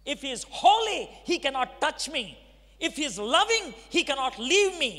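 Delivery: reenacting his furious reaction to the call to Jesus; angry outburst. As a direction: spit it out in defiant rage. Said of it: "that was my reaction at that time".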